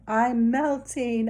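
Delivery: slow witch wail; Wicked Witch. Retold or imitated. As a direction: cry it out like a melting witch. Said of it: "sounds just like the Wicked Witch of the West".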